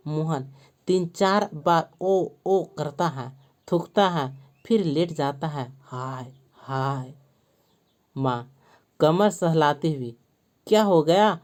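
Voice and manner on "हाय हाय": moaning. A narrator's reading.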